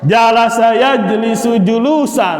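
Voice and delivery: recitation drill. Shown as a demonstration. Teacher reciting the masdar gallery aloud, chanting. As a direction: recite each pattern clearly for the class.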